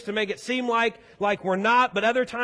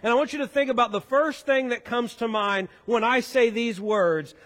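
ordinary delivery

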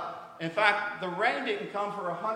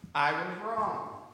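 slow admission; much slower than usual. Retold or imitated. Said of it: "Can you say it louder?".

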